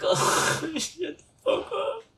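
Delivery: squeaky laughing shut up; laughing mock retort.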